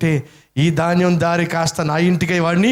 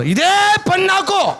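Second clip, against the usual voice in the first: sarcastic retort; slower than usual. Acted. Thief mocks the accusation.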